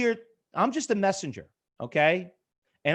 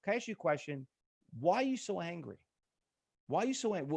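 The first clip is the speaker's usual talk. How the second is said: calm reassuring; 8 dB quieter, faster than usual. Retold or imitated. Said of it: "And I said, "Sir," I said, "Calm down".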